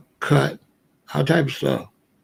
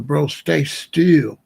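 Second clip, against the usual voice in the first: slow, drawn-out and firm, a bit louder; urgent plea; 4 dB louder, much slower than usual. Retold or imitated. To his friend in the chokehold, slow firm warning. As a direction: press each word slowly so he freezes. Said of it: "I'm telling him".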